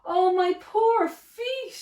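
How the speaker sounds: slow, high moan. A narrator's reading. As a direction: groan about sore feet. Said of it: "he moaned".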